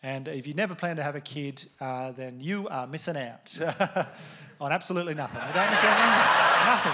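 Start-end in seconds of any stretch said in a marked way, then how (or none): 5.5-6.9 laughing it out loud and slow. Real, not acted. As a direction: push the line through real laughter. Laughing punchline to audience.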